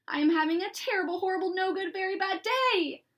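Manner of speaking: put-on a sulky kid wailing about his day; whiny kid complaint. Alexander complains to everyone.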